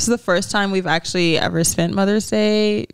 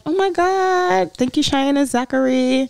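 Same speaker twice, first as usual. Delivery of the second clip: gushing thanks; 4 semitones higher than usual. Retold or imitated. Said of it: "I was like".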